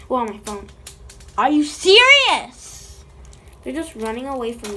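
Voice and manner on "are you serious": loud, slow disbelief; loud slow are you serious; exasperated. Real, not acted. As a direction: drag out the outrage at the mess. Glue on her phone.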